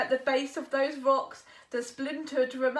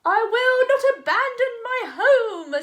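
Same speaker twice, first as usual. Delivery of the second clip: a high, loud, defiant little frog; little frog refusing to budge; stubborn frog; 9 semitones higher, 12 dB louder than usual. Acted. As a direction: dig in your heels as the frog. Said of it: "said the frog".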